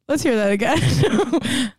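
usual speaking voice

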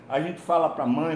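ordinary talk, nothing marked

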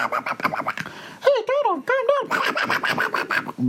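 high, squeaky cartoon mouse; Mickey Mouse voice. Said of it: "That was Mickey Mouse".

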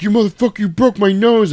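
loud, high acted outrage; mock anger. Football player confronting him, hammed-up anger. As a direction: yell it as the wronged football player.

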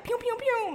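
mock gunshot pew pew, high pitched; playful laser sound. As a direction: make little zapping shots with the voice. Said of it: "she shot me down".

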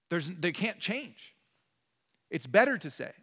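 ordinary talk, nothing marked

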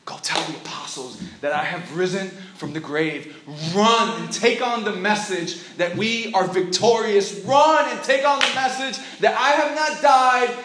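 rally her to run with the news; preached command rising to a cry; building proclamation. Acted. Jesus commissions Mary, building.

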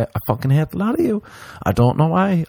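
high squeaky hatred; high mock rant. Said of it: "I'll say".

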